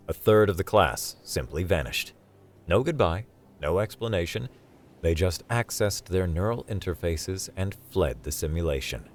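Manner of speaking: ordinary delivery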